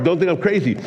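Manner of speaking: ordinary delivery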